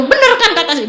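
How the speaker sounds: high, loud and laughing; overjoyed. Retold or imitated. Friend rejoicing that she was right, overjoyed laughing exclamation. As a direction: crow it happily, half laughing. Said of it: "Dia ikut seneng banget".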